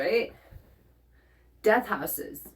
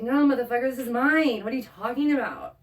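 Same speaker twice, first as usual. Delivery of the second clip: higher, louder, fed-up snap back; heated mock retort, higher and louder; indignant retort; 5 semitones higher, 4 dB louder than usual. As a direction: tell the thief it's yours, heated. Defending own treasure from goblin.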